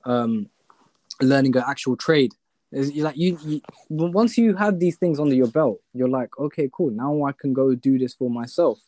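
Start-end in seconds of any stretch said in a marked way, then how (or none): none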